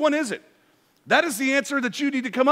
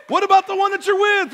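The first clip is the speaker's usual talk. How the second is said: higher, louder challenge on "What about the one that you're with?"; emphatic repeat; 8 semitones higher, 5 dB louder than usual. Shown as a demonstration. Preacher challenging men in the congregation, louder and higher. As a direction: push the repeat up and out.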